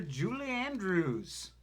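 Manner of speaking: slow, drawn-out toast; toast cheer. Acted. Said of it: "with the cheer".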